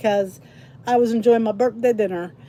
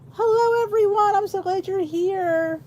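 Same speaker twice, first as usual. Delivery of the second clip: cheerful greeting; 7 semitones higher than usual. Real, not acted. Host welcomes viewers joining the live, high and glad.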